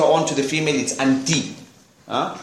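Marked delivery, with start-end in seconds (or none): none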